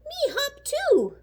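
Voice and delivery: high, slow little kangaroo call; young kangaroo shout. A narrator's reading. Young kangaroo calls out to the hippo, high and slow. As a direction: squeak it out like a tiny joey. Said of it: "A young kangaroo shouted".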